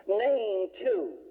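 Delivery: high-pitched, imitated defiance; sassy challenge. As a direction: toss it back as a mock dare from an unimpressed girl. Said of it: "put her hand on her hip".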